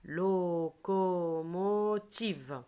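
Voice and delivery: "Locomotive" very slow, every syllable held long; "Locomotive" as four long, even beats; stretched syllables. Shown as a demonstration. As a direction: draw out "Locomotive" so each sound can be heard. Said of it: "Écoutez très bien".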